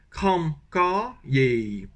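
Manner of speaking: southern Vietnamese kram ka ye, very slow; slow southern Vietnamese, ending on ye; southern Vietnamese accent. Shown as a demonstration. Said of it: "avec l'accent du sud".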